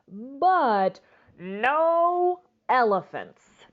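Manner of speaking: drawn-out, slow "but no elephants"; slow firm refusal. Acted. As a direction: stretch each word out, firmly. Grandma Tildy's firm refusal.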